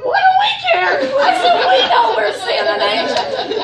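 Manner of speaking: high, loud, laughing and tearful; ecstatic shout. Retold or imitated. Answering her friend about the angel, crying and laughing. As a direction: shout it through laughing tears. She shouts back in awe.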